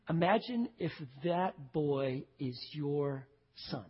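slow and soft, letting it sink in; slow, hushed imagining. Shown as a demonstration. Preacher urging his congregation to picture their own son, slow and hushed. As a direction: draw them in slowly and quietly. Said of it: "Do it".

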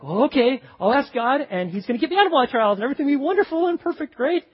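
fast, high and bright, sing-song; mock naive optimism. Mocking the believer who expects no trials, fast and high. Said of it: "It's not like".

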